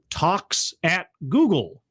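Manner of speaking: careful, spaced-out "Talks at Google"; slow, separated words. Shown as a demonstration. Host introducing the talk series, spacing out "Talks at Google" slowly. If you say it fast enough.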